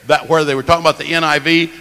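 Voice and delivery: ordinary talk, nothing marked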